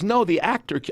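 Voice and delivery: lightly voiced Cruise, setting him straight; imitating Tom Cruise.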